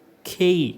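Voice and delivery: കെ said long and stressed. Shown as a demonstration. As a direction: lift and lean on കെ. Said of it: "കുറച്ച് ഉയർത്തി". ('കെ' ഉയർത്തി, ഊന്നി)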